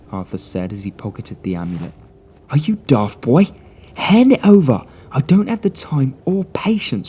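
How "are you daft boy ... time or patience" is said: a much higher, louder shriek of a posh man out of patience; shrieking gentleman. Acted. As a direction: snap at the boy to hand it over. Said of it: "shrieked the man".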